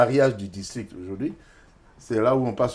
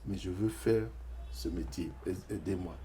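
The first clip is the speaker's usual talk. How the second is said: softer, near-tearful plea; quiet pleading; 3 semitones lower, 12 dB quieter than usual. Retold or imitated. Young man pleading with the second clerk, soft and near tears. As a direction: ask for help softly, almost crying.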